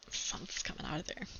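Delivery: fast, hushed scared whisper; a quick frightened whisper. Acted. Scared voice at the closet.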